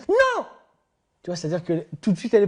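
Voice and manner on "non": mock yell. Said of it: "Je dis".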